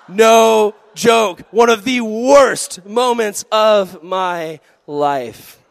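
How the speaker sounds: loud, high, very slow exclamation; drawn-out, hammed-up despair; emphatic outcry. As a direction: shout it word by word in comic anguish. Climax of humiliating story.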